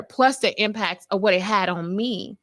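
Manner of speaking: ordinary talk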